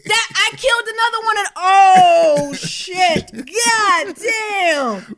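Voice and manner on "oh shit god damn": loud, drawn-out startled swearing; startled cursing. Real, not acted. Killing another one and sees a big spider, startled outcry. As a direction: cry out in shock.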